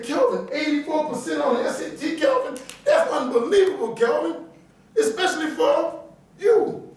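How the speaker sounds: high, rising mock-amazed teacher voice, slowing at the end; voicing amazed teacher. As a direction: play the stunned teacher, pitch climbing on each Calvin. Teacher Miss V reacts, amazed.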